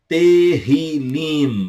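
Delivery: "Terrilim" very slow, with a breathy h and lips closing on the final m; Hebrew pronunciation. Shown as a demonstration. Teacher modelling "Terrilim" for students, slow and careful. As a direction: stretch out "Terrilim" and shut the mouth at the end. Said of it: "No final você fecha a boca".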